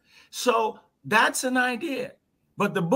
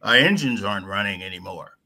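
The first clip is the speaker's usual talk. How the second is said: pilot radio voice; 12 semitones lower than usual. Pretend. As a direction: call it in like a pilot in trouble. Pilot voice continues.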